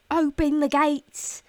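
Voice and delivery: loud, high, drawn-out call; mock commanding shout. Host playing at arriving home to her gates person, commanding shout. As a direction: holler it out like arriving at your castle. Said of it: "I could be like".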